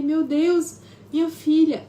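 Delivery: high, loud horrified gasp of words; shocked cry. Retold or imitated. Mother realising she hit her daughter, horrified cry.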